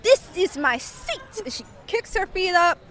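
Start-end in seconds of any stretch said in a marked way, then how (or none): 0.0-1.2 higher-pitched bad Italian accent; terrible Italian accent. Seatmate claiming her chair, bad Italian accent. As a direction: insist in a fake Italian accent. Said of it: "this is what we're going".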